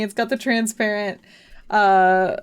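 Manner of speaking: normal talking voice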